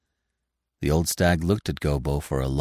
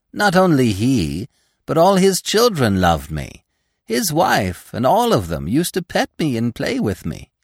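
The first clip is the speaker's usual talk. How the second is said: put-on high, loud, gushing young deer; animated, delighted boasting; Gobo boasting fondly; 9 semitones higher, 5 dB louder than usual. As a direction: gush proudly about being loved.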